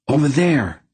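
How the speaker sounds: sharp high alarmed cry. A narrator's reading. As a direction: shout the warning, pointing. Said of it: "Garion cried".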